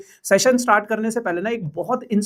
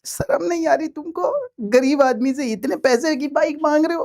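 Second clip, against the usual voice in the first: high, whiny pleading as a poor man; mock poor man pleading; 6 semitones higher than usual. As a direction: beg in a hammed-up pitiful voice. Teacher begging, mock poverty. (गरीब आदमी की नाटकीय गुहार)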